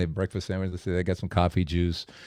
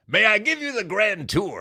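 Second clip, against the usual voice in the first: higher, lightly gravelly host offering a tour; gravelly voice guy; 10 semitones higher than usual. Retold or imitated. Hotel worker greeting another couple, lightly gravelly. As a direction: call it out to new guests like a proud host. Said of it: "I hear this gravelly voice guy".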